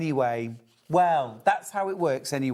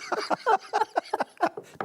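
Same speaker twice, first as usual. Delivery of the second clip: shrieking laugh; high burst of laughter. Real, not acted. Laugh at cankles.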